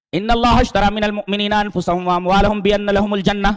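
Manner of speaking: high, loud, slow chanted verse; slow Quranic recitation. Acted. Preacher reciting the verse to listeners, slow and loud. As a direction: draw the verse out slowly, ringing.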